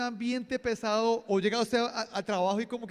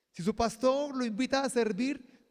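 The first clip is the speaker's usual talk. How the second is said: usual speaking voice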